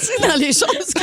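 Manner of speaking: helpless laughing fit; hysterical laugh. Real, not acted. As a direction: laugh so hard you can barely talk. Group bursts into laughter.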